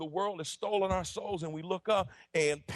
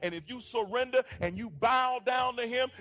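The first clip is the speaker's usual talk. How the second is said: rising, louder preacher's build toward a shout; preaching at full force; 3 semitones higher, 3 dB louder than usual. Acted. Pastor preaching deliverance to his church, at full force. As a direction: pour it out over the congregation.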